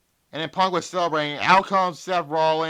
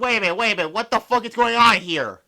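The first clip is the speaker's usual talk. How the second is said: a bit higher and louder, wait a minute thrown out in shock; stunned disbelief; 7 semitones higher, 6 dB louder, faster than usual. Retold or imitated. Recapping the twist to viewers, mock-baffled outburst. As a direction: act out the disbelief while recapping. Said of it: "I'm like".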